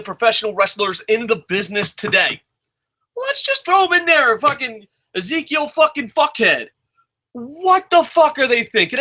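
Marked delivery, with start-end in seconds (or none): 3.2-9.0 pitched way up, exasperated ranting; high angry rant. Real, not acted. Fan ranting at the show's bookers, high and exasperated. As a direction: vent at the writers in a high, disbelieving voice.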